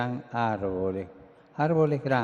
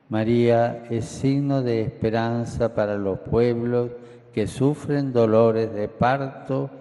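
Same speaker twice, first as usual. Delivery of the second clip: loud, fervent sermon delivery; booming balcony speech; 4 dB louder than usual. Real, not acted. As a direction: lift your voice over the crowd. Fervent passage about the Virgin.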